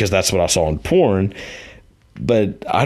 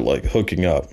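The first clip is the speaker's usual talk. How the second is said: ordinary talk, nothing marked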